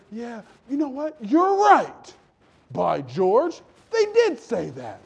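high, rising indignant agreement; worked-up agreement. Acted. Miss Ronnie siding with her gossiping friend, worked-up agreement. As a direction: build to a loud, scandalized yes.

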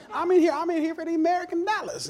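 very high-pitched country accent impression; grandpa's country accent. Grandpa in his junk shop, exaggerated country accent. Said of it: "That's how he talked".

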